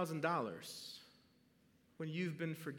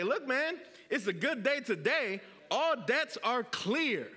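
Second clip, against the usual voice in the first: joyful imagined coworker; 6 semitones higher, 6 dB louder than usual. Pretend.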